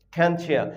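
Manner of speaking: "খ্যানচিয়া" as khen-chia; fuse t and y into ch in "খ্যানচিয়া"; linked pronunciation. Shown as a demonstration. Teacher confirms how we said it, "খ্যানচিয়া" linked with ch. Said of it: "আমরা এটাকে কিন্তু বলে নিলাম".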